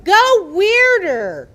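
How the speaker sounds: loud, slow, high shout; cheering go weirder; drawn-out shout. Real, not acted. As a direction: stretch it out and yell it. Crowd eggs performer on.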